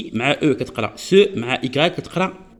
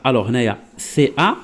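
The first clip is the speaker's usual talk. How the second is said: ordinary delivery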